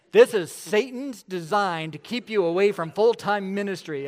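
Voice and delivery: high, loud scolding down the phone; wife blasting the young man; loud rebuke. Retold or imitated.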